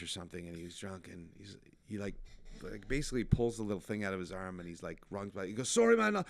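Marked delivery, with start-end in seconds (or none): 5.7-6.2 put-on high, loud, botched Irish accent; hammed-up mock Irish shout; bad Irish accent. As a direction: attempt an Irish accent and miss. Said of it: "that's not an Irish accent".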